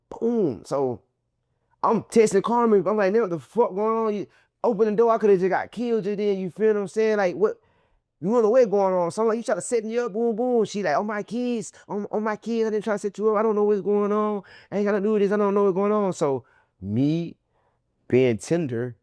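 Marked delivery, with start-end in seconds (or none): 3.1-6.4 high, heated what the fuck; accusing. Retold or imitated. Angry text to Carmen, retold. 9.4-10.6 high accusation of a setup; accusing. Retold or imitated. 11.0-16.1 put-on high, pleading denial on my kids; mock pleading woman. Girl denies setup, pleading.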